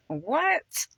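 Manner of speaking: high-pitched, incredulous "What?"; sudden leap up in pitch, astonished; amazed aside. Real, not acted. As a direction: react in happy disbelief to the reading. Amazed reaction to what she read.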